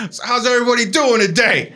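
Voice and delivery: high, projected showman greeting; imitating an over-eager pastor; crowd-rousing pastor. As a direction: work the room like a hammed-up preacher. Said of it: "he's gonna turn this crowd around".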